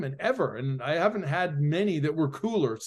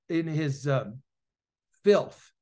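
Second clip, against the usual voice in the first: usual speaking voice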